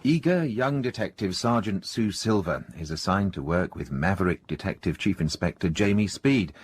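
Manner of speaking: ordinary talk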